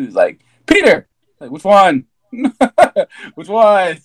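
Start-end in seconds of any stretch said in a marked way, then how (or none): 0.7-1.1 loud high call of a name; retold shout of Peter. Friend calls out in retold scene. 1.5-4.0 asking through laughter; retold line broken by laughs; laughing.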